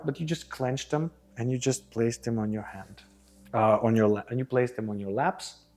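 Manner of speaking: ordinary talk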